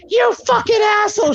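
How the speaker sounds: very high-pitched imitated outrage; mock outraged wife. Retelling wife cursing husband who scared her, mock outrage. Startled wife swears, retold.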